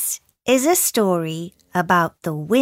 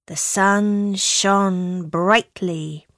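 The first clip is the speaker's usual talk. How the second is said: ordinary delivery, nothing marked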